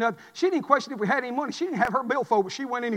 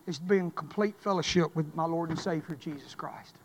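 hushed preaching; 5 semitones lower, 5 dB quieter than usual. Acted. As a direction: lean in and plead quietly. Hushed intimate close of sermon.

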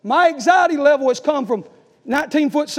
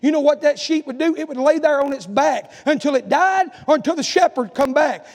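normal talking voice

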